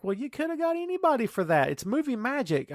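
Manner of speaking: high-pitched incredulous comeback; incredulous retort. Retold or imitated. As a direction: throw it back in disbelief, pitched high. Own incredulous reply, acted.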